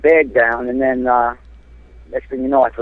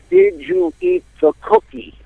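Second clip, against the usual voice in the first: high, slow, over-mouthed question; exaggerated slow question; slower than usual. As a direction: spell it out big for someone who can't hear. Reading big cardboard sign aloud.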